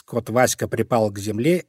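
usual speaking voice

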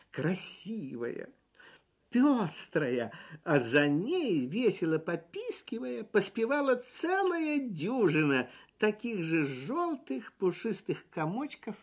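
ordinary delivery, nothing marked

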